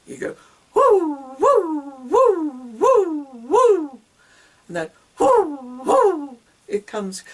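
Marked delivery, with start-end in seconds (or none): 0.7-4.0 high, loud, punchy hooting woos; baby-bouncing woo. Shown as a demonstration. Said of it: "The noise that you make if you had a baby". 5.2-6.4 high, loud hooting woo; baby-bouncing woo. Shown as a demonstration. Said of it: "baby bouncing".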